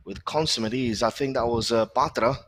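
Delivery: ordinary talk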